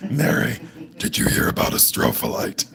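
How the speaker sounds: very low monster rumble; put-on man voice. Prank caller to own mother, deep monster voice. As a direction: creep them out with a deep fake monster voice. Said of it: "I call my mom and I do that voice".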